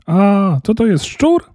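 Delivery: put-on high startled cry of a girl; high-pitched alarmed question; startled shriek. A narrator's reading. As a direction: shriek as if spotting a rat. Girl startled by a supposed rat.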